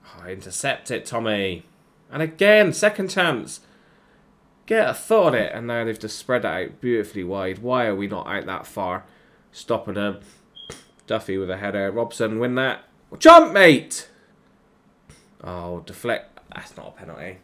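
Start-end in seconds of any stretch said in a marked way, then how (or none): 2.1-5.5 high, loud cheer at the screen; urging the chance home, voice up; excited shout. Real, not acted. Gamer yelling at a chance on goal, excited. 13.2-14.1 high, loud shout of a command; loud yell. Real, not acted. Gamer shouting at his defender to jump, loud yell. Yelling at player on screen.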